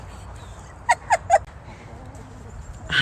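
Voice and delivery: high giggle. Real, not acted. Embarrassed laughter.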